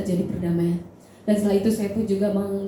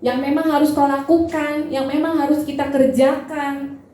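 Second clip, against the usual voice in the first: raised, higher voice with drawn-out phrase endings; full-force preaching; 6 semitones higher, 4 dB louder than usual. Real, not acted. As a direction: proclaim it emphatically to the congregation. (berkhotbah lantang dan berapi-api)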